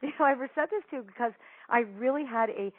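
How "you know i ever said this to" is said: rushed, higher, gushing mock apology, with a laugh. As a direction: pour out a sheepish apology, fast. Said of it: "I was like".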